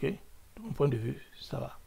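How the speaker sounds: hushed whisper of De mon point de vue; De mon point de vue whispered as a private aside. Shown as a demonstration. Repeats phrase as an aside.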